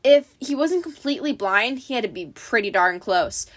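ordinary delivery, nothing marked

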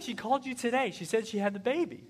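high, insistent wife voice; wife explaining. Retold or imitated. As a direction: push him to remember. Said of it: "She's like".

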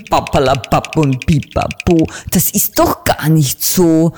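put-on mildly booming, scolding old raven; a hint of thundering rebuke; mock thunder. Raven councillor rebukes the little bird, mildly booming. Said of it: "donnerte da die laute, knarrende Stimme".

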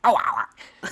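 high, loud dog howl. Pretend. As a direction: throw your head back and howl. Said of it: "That was actually so accurate".

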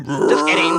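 wild screaming laugh; primal scream. Pretend. Fake primal scream joke.

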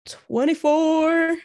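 slow stretched reveal; drawn-out announcement. Acted. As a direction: stretch the number out like a host. Dramatic episode number announcement.

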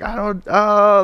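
slow, high, lost for words; flustered stammer. Retold or imitated. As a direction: stammer like a panicked recruit. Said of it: "and you're just like".

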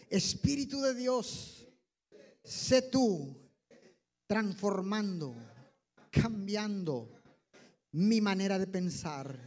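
earnest prayer, phrase by phrase; led prayer. Acted. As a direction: lead the church in fervent prayer. Leads congregation in prayer. (oración guiada y ferviente)

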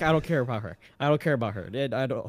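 low, soft brush-off; mock indifferent quote. Mocking Markiplier ignoring the maids, a low brush-off. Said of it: "he'd be like".

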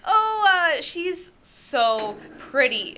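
high "oh", then slow, melting words; smitten swooning. Retold or imitated. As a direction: swoon slowly over a crush. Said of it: "and be like".

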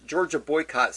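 usual speaking voice

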